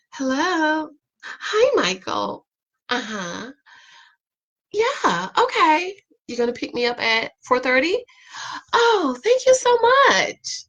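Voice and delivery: high, sugary phone greeting; sweet phone voice to husband. Retold or imitated. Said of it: "everybody's like".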